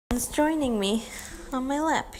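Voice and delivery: normal talking voice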